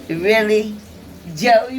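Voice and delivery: mock disbelief at the name; incredulous. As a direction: throw back the name in disbelief. Retold incredulous reaction.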